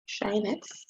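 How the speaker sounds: "Shine x" softly, with an opening sh; soft repeat of "Shine x", sh at the front; quiet "Shine x", rising at the end; sinh as "shine". Shown as a demonstration. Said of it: "which we pronounce as shine x".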